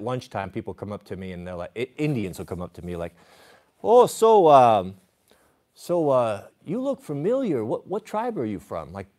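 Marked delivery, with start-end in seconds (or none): 3.8-8.9 light mock of a friendly firefighter's pidgin English, jumping higher on oh and look; a hint of pidgin English, friendly and curious; imitating pidgin English. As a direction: casually imitate how the guys talk at fire camp. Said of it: "giving me the inside language, ... kind of the pidgin English".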